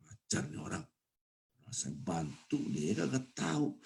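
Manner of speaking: resentful under-the-breath muttering; annoyed mutter. Retold or imitated. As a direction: grumble to yourself, offended. Annoyed uncle grumbling at family gathering.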